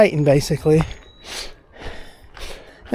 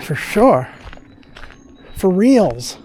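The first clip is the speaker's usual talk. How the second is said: ordinary delivery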